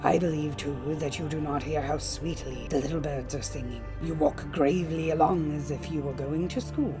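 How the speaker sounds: low, soft wolf voice; sly, quiet persuasion from a wolf; coaxing wolf. A narrator's reading. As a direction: tempt her gently, hushed and low. Wolf coaxes the girl.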